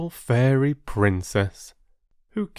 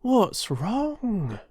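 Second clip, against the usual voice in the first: concerned friend; 11 semitones higher than usual. Acted. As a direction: ask her kindly what's wrong. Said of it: "in concern".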